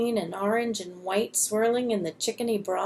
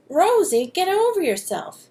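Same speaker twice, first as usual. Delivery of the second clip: a hint of a cold, dismissive boy; loud snap of "Rosie"; lightly voiced spiteful brush-off; 7 semitones higher, 5 dB louder than usual. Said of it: "in the cold voice".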